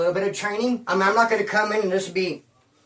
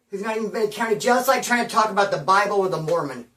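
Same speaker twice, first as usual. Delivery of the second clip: usual speaking voice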